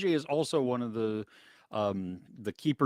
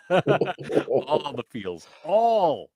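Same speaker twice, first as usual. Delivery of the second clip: laughing; 2 semitones higher, 5 dB louder, much slower than usual. Real, not acted. Gushing about a show.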